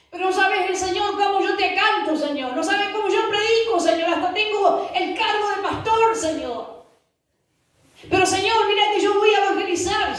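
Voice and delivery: high-pitched, insistent self-justifying protest; boastful churchgoer's pleading. Acted. Believer listing merits to the Lord, insistent and pleading. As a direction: plead high and fast, letting each "Señor" fall.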